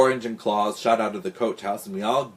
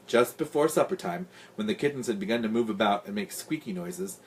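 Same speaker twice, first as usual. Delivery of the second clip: usual speaking voice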